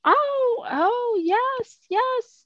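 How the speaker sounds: high, eager little gasp and yeses; light up with sudden delight; excited. Real, not acted. Suddenly remembers the writing prompt.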